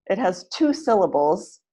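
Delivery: ordinary talk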